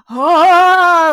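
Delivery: loud, high hammed-up ghostly moan; mock moan. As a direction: moan it like a silly zombie. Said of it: "like, moaning".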